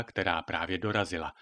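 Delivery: usual speaking voice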